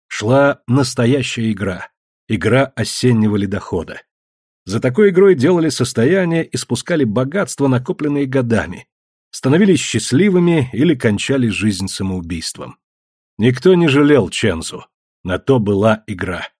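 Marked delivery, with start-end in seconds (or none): none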